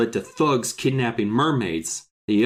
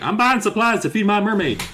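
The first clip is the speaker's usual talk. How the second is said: high-pitched, gleeful blurting; mock excited announcement; 8 semitones higher, 4 dB louder than usual. Retelling how he told everyone in town, mock excited announcement. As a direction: blurt it out high for all to hear. Mocks blabbing to whole town.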